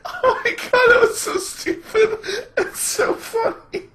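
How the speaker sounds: real helpless laughter, very high squeaky voice; wheezing, can barely talk for laughing; crying with laughter. Real, not acted. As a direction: squeak the words out through tears of laughter. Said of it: "Oh, God, I'm crying".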